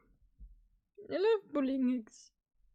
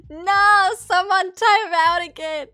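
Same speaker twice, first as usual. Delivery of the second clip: much louder, indignant shout at chat; outraged shout; 5 semitones higher, 13 dB louder than usual. Real, not acted. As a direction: yell it in protest.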